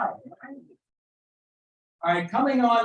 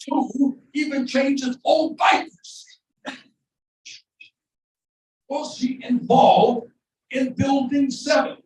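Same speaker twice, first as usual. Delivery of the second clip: high, slow, declamatory mock orator; George Gallagher impression; 7 semitones higher, 4 dB louder than usual. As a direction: parody a ranting host, stretching each phrase out. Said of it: "do my George Gallagher impression".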